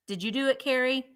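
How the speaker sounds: ordinary talk, nothing marked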